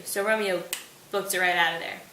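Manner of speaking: plain reading voice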